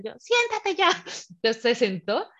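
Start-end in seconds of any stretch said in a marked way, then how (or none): 0.2-0.9 much higher, acted yell to sit; retold exasperated order. Labouring woman orders hovering partner to sit, acted exasperated yell. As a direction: act out losing patience.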